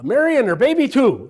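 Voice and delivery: mock oath. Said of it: "or something like that".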